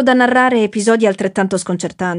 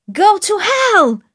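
a higher, slow, drawn-out cry of rage; furious shout; 11 semitones higher, much slower than usual. A narrator's reading. Judy shouts up at the passing plane, slow and furious. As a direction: hurl it at the sky. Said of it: "col pugno teso gridò".

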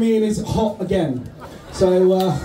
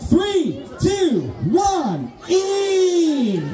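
shouted countdown; 8 semitones higher, much slower than usual. Real, not acted. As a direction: call the start like a race starter. Host starts chili-eating countdown.

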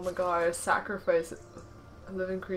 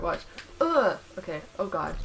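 normal talking voice

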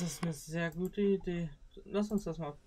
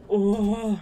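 startled shout; 4 semitones higher, 14 dB louder than usual. Real, not acted. Jump scare in horror game.

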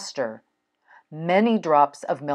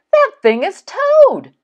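very high, loud exclamation; amazed storybook character; astonished Frog; 9 dB louder than usual. Acted. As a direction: blurt out the startling discovery. Frog shocked on recognising Toad.

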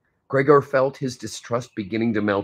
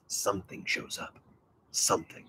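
whisper; 3 semitones lower, 14 dB quieter than usual. Real, not acted. Hushed reveal in talk.

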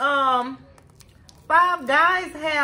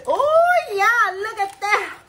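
excited oh; 10 semitones higher, 4 dB louder, slower than usual. Real, not acted. Unwrapping the lettuce burger.

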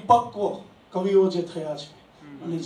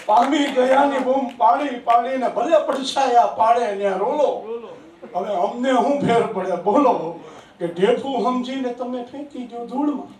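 raised, sing-song reciting voice; poetry recitation; 6 semitones higher, 7 dB louder than usual. Acted. Poet reciting his verse to an applauding audience, raised and sing-song. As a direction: declaim each line to the hall, savouring it.

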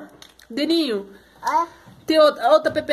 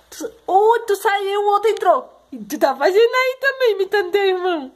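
high, loud motherly astonishment; real surprise, pitched well up; startled exclamation; 8 semitones higher, 5 dB louder than usual. Real, not acted. Mother exclaims at children's mischief.

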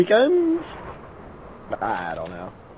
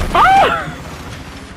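high scream. Real, not acted. Sudden scream in game.

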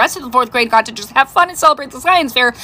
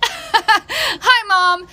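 much higher, giggly bright "hi, mom"; chirpy daughter; 9 semitones higher than usual. Retold or imitated. Mom imitating her daughter waking from the seizure. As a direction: chirp it like nothing happened.

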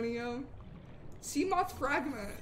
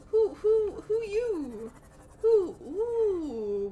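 playful hooting; 5 semitones higher, 4 dB louder, much slower than usual. Pretend. Playing on the word who.

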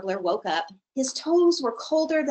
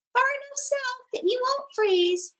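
high insect voice. Acted. Darner answers.

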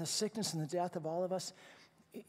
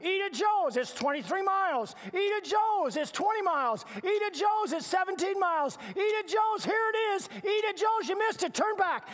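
high, loud sign-announcer voice, each ad louder; roadside billboard; 13 semitones higher, 9 dB louder than usual. Retold or imitated. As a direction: call out the signs like a barker. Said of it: "do you know what I'm talking about".

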